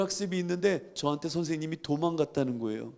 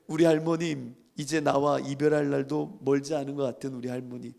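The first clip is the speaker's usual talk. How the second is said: usual speaking voice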